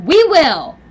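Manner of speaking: loud, high shout; yelled retort. Retold or imitated. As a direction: yell it back in defiance. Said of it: "And I was like".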